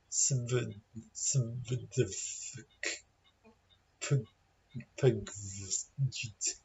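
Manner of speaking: Sv-d-f-k-p-k-v-j-t haltingly, as soft bare consonants; consonants without vowels. Shown as a demonstration. Teacher retrying a vowelless word, soft halting consonants. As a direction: stumble quietly through consonants with no vowels. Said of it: "Can we say it without a vowel".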